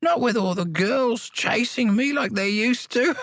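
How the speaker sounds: put-on high, slow old man voice, teasing; a cheeky old codger brushing it off; whimsical old man. A narrator's reading. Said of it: "with a touch of whimsy in his aged voice".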